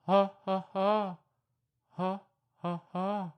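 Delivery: loud ha ha monkey hoots. Pretend. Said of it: "a little louder".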